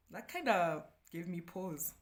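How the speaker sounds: ordinary talk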